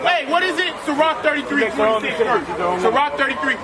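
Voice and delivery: ordinary talk, nothing marked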